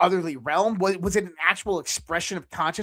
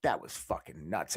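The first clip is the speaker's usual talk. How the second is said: awed hush; 9 semitones lower, 9 dB quieter than usual. Retold or imitated. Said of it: "and I'm like".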